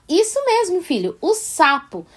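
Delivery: elogio agudo que termina devagar em "sapo"; correção encorajadora. Acted. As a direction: confirme e ensine a palavra sem repreender. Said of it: "Você corrige sem dizer não". (incentivo de mãe, começando bem agudo)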